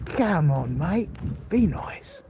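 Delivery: put-on pleading little mosquito with an Australian accent. Mosquito begging for a bite in a joke, mock Australian accent. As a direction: coax them in a hammed-up Australian accent. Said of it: "I don't know if that's Australian".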